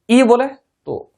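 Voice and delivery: usual speaking voice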